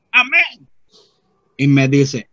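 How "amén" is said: loud, high, falling amen; comic amen. Retold or imitated. Simplicio answering the brother's blessing, comic amen. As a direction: throw back a big high amen.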